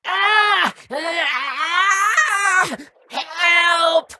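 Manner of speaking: very high creature shriek; long high-pitched wail for help; screaming for help. Acted. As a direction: scream out in agony, stretching each cry.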